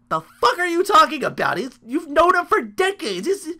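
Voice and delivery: high, loud, frantic outburst; panicked Seth. Acted. Seth panicking to Triple H about Kane, high frantic yelling. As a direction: shriek it as a terrified, disbelieving Seth.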